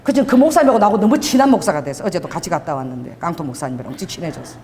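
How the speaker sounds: usual speaking voice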